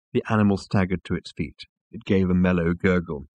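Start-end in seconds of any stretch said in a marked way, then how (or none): none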